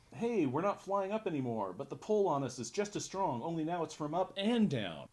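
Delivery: high, lilting put-on quote; acted kid's report. Flying kids reporting the pull on them, high acted voice. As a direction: call it out high, like a character mid-air. Voicing the kids' line from the book.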